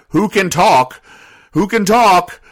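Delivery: "who can talk" punched high and loud; emphatic repeated aside. Real, not acted. Repeated pointed aside on talking birds.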